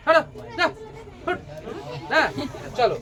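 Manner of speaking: high, dismissive "move, go"; shooing away. Acted. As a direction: brush the child off. Driver shoves the boy away.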